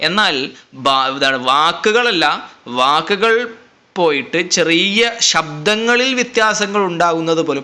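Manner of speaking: normal talking voice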